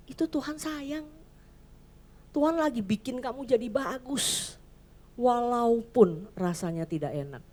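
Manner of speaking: usual speaking voice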